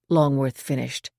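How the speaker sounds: ordinary delivery, nothing marked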